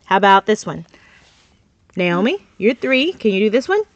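ordinary talk, nothing marked

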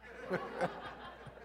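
breathy chuckle; preacher laughing at his joke; soft laugh. Real, not acted. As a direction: laugh at the punchline.

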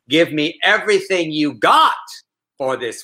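slow, measured poetry recital; mock-serious poetry reading; read as a poem. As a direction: recite it like a solemn poem, drawing out each phrase. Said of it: "I just read it as a poem".

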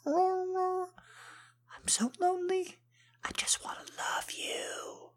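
slow, very high whispered puppy whine; high slow whimpering puppy voice. Pretend. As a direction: plead like a lonely puppy scratching the door. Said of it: "just like I'm clawing against you now".

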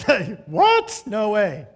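mock outraged Philistines. Preacher voicing Philistines refusing to give up foreskins, mock outrage. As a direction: ham up the shocked refusal.

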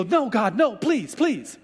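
high, urgent begging; pleading. Retold or imitated. Moses interceding with an angry God, begging high and urgent. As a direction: plead desperately for mercy. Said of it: "Moses would intercede".

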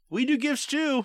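high, put-on me-too voice; mock eager claim. Mocking Hanukkah copying Christmas gifts, high put-on voice. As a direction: pitch it way up, imitating.

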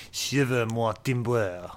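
put-on slow jokey French-accented pirate growl; mock French pirate. Joking about French pirate talk, mock French pirate accent. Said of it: "pirate talk in French".

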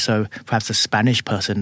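normal talking voice

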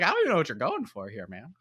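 quick, exasperated jab at a bad accent, in a put-on voice; exasperated mock complaint. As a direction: toss it off like you can't believe what you heard. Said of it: "I was like".

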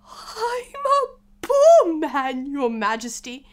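very high, loud, slow tearful wail; pleading Hatter. Acted. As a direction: beg in a sobbing wail, easing off at the end. Hatter begs on one knee.

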